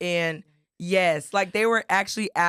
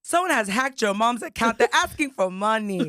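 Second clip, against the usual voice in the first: imitating an alarmed aunt, higher and slower; aunt's urgent voicemail; 5 semitones higher, 3 dB louder than usual. Aunt warning niece about mom's hacked account, mock-urgent. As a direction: act out an insistent phone message, pitch climbing at the end. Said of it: "she left a long detailed voicemail".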